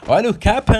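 high, loud gamer shout; exultant headshot yell; excited call-out. Real, not acted. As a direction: shout it in triumph, voice leaping up. Calls out a headshot in game.

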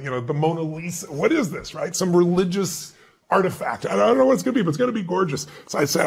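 usual speaking voice

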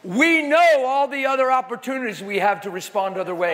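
high, loud, laughing preacher's shout; preaching at full force. Acted. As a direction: fire up a weeping congregation. Preacher's peak before crying crowd.

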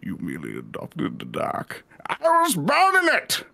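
low, menacing villain voice; deep masked villain threatening; Bane impression. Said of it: "Someone said to impersonate Bane from Batman".

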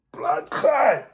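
normal talking voice

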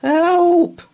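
high, loud shouts for help. A narrator's reading. Paddington stuck in the bath, shouting loudly. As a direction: yell for help. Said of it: "then very loudly".

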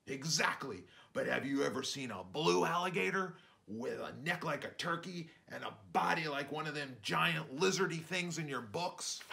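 dad telling a tale. Acted.

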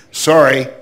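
loud, clipped brush-off; curt dismissal. Retold or imitated. Clerk waves off the mother from Milwaukee, loud and dismissive. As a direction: slam the door on them with one word.

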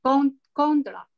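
Con- stretched slowly, then contra stressed up front; teacher drills the stress for a student; stress on first syllable. Shown as a demonstration. Slow stress drill. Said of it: "重音在前面".

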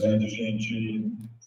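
slow, echoing, delayed copy of the voice; cathedral echo. Real, not acted. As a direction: voice bouncing back from a big hall. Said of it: "O que que tá com eco aqui?".

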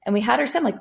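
usual speaking voice

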